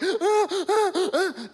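put-on high gasping sobs of a hyperventilating child, half laughing. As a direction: gulp for air like a sobbing kid, half laughing. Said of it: "where they can't even breathe".

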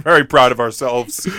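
ordinary talk

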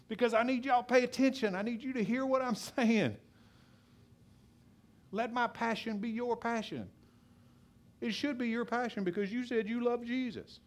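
high, fervent appeal to the congregation; passionate preaching. Real, not acted. Pastor urging the church to pay attention, impassioned and high. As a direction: push it up high with real passion. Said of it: "You know why I talk so loud?".

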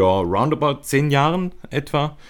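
ordinary talk, nothing marked

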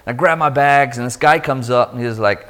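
ordinary delivery, nothing marked

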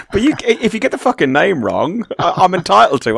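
high, loud, laughing self-defence; laughing protest. Real, not acted. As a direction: stammer it out mid-laugh.

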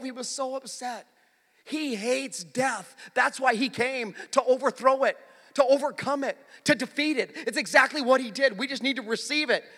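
insistent preaching, loud and high; forceful preaching. Acted. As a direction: drive each phrase home to the congregation.

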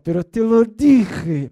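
loud, slow angry "I told you"; angry snap. Retold or imitated. Pastor imitating an angry spouse, loud and slow. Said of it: "cuando uno está enojado".

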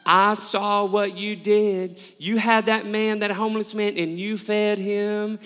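thick, drawn-out southern drawl; slow southern accent, stretching the vowels. Retold or imitated. Elderly lady praising a good deed, heavy southern accent. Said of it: "in the most southern accent".

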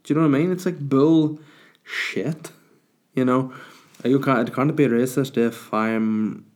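usual speaking voice

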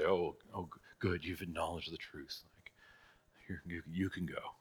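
soft, low, offhand pardon; mock lenient dismissal. Judge waving a truth-telling criminal free, mock lenient and quiet. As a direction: let them off quietly, voice dropped low.

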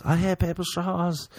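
high-pitched comic complaint; mock sex cry. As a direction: blurt it high, as if mid-sex. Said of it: "that I'm gonna say during sex".

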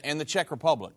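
usual speaking voice

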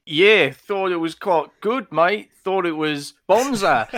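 high, slow, hammed-up Australian accent; bad Australian accent. Co-host answers the intro in a bad Australian accent. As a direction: play up a cartoon Aussie, high and drawn out. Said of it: "I can't do an Australian accent at all".

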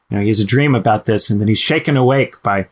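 ordinary delivery

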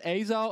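Azo said high as a correction; correcting pronunciation. Retold or imitated. Pharmacist corrects his pronunciation of Azo, high. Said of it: "and she goes".

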